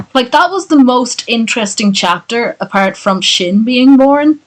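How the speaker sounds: usual speaking voice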